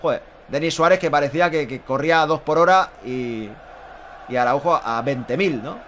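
ordinary delivery

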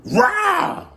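sudden loud high shout to scare; startling boo. Pretend. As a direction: jump out and yell boo. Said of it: "Did I scare you?".

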